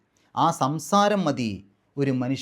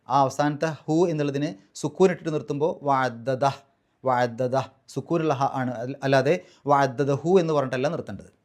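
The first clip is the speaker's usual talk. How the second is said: usual speaking voice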